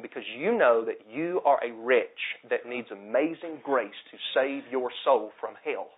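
ordinary talk

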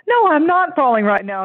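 high-pitched mock protest; protesting patient. Skit patient denying it to the psychologist, protesting. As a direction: answer back as the flustered patient.

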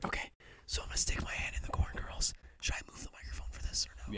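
soft ASMR whisper. Real, not acted. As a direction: whisper close to the mic. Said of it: "we have to whisper".

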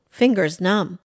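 plain reading voice

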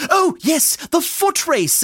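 high, startled realisation; flustered, high-pitched recollection; sudden remembering. Acted. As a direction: exclaim it as it comes back to you.

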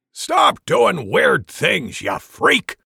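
loud, slow, gruff rebuke; annoyed shout. Acted. Mormek flicking Gadisa's ear, loud annoyed rebuke. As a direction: bark it at the weirdo. Dwarf snaps at rogue.

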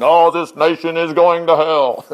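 hammed-up prophecy of doom; mock doom-saying prophet. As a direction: moan it like a doom-and-gloom preacher. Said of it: "He's not going".

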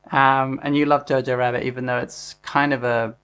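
ordinary delivery

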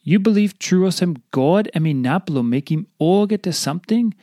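ordinary delivery

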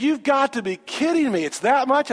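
imitating her shocked outrage; mock disbelief. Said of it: "She said".

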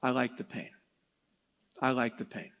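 soft low quote. Retold or imitated. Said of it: "his response always was".

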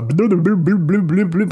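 bob the bloops up and down like warbling tape; playful mock tape-warble bloops; imitated warbly bloops. Shown as a demonstration. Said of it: "all warbly and bobbly".

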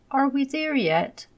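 put-on high, eager child voice; little boy voice. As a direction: ask it like an impatient little boy. Child asking from car seat.

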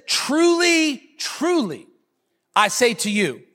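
weighty, spaced-out delivery of "Truly, truly"; emphatic. Acted. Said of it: "he's emphasizing himself".

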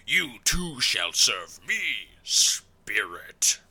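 very slow, dark lord voice; Mordekaiser. Acted. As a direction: draw out the command, whisper the last word. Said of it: "he says, respectively".

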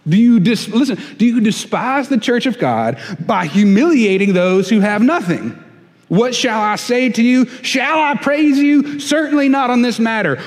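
laying it on thick. Acted. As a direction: preach it like a furious apostle scolding his church. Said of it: "he lays it on thick".

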